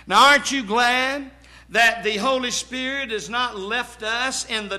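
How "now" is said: ordinary talk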